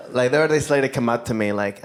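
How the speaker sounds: ordinary talk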